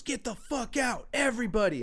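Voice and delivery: high, mock furious yell; pissed-off dad. Dad catching kids ditching school, imitated angry yell. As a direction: imitate an enraged dad throwing everyone out. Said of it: "Dude comes home super pissed".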